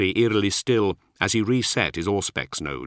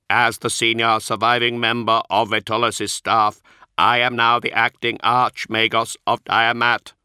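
a polite machine reciting its excuses; synthetic Magos voice; 4 semitones higher, 5 dB louder than usual. Acted. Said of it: "Archoi's synthesized voice".

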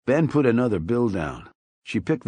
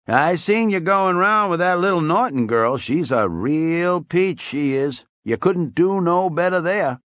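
put-on higher, loud folksy old barfly voice; old drunk Weasel; 8 semitones higher, 4 dB louder than usual. A narrator's reading. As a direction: play the chatty tipsy old-timer, pitched up.

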